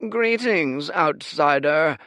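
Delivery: high, slow, stiff formal greeting; drawn-out elder's voice, cool; flat greeting. A narrator's reading. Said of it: "he said flatly".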